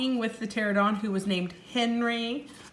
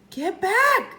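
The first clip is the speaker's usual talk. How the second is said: 1 shouted; 11 semitones higher, 4 dB louder than usual. Acted. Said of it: "Jack shouted at her".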